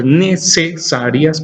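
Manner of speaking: "necesarias" slowly, syllable by syllable; sounded out for spelling. Shown as a demonstration. Teacher dictating C and S, "necesarias" sounded out. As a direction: separate each syllable of "necesarias". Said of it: "con C y con S".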